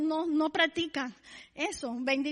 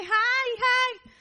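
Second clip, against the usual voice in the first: mock neighbor grunt; 7 semitones higher than usual. Neighbor's greeting mocked.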